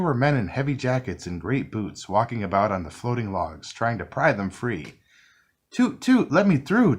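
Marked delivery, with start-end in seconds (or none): 5.7-7.0 high, louder demanding toot. A narrator's reading. Tugboat demands the log men let him through, high and louder. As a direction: insist they let you pass. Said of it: "demanded Scuffy".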